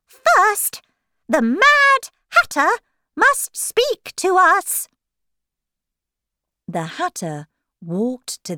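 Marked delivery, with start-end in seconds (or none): 0.1-4.9 high, loud court-crier voice. Acted. Rabbit calling the Hatter to speak, high proclaiming voice. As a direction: announce the witness high and loud.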